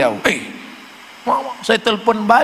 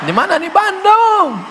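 mock flirty phone call; 10 semitones higher than usual. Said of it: "jangan mendesah-desah".

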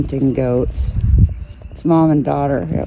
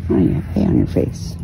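soft whisper; 5 semitones lower, 6 dB quieter than usual. Real, not acted. Whispered soothing to goat.

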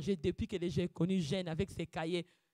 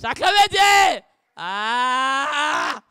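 loud, high, slow cry of praise; roaring praise call; preaching shout; 8 semitones higher, 18 dB louder, much slower than usual. Acted. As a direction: shout it to the back row.

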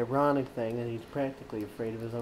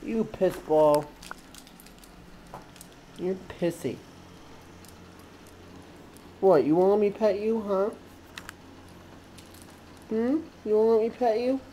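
high pet-talk voice; 10 semitones higher, 7 dB louder than usual. Real, not acted. Owner coaxing her cat to be petted, high pet talk.

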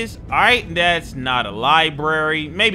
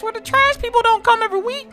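high-pitched complaint. Acted. Complaining about uncollected trash.

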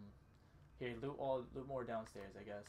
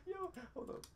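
high-pitched. Pretend. Still in zombie play.

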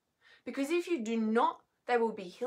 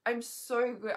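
normal talking voice

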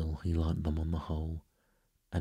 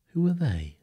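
high, loud curious question; inquisitive girl; 11 semitones higher, 6 dB louder than usual. A narrator's reading. Wendy asks Peter about the Lost Boys, high curious girl's voice.

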